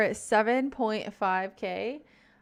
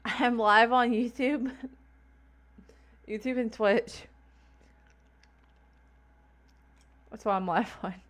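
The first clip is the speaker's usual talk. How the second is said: lisping from ice numbness. Real, not acted. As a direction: numb lips, slight lisp. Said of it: "The ice gave her a crazy lisp".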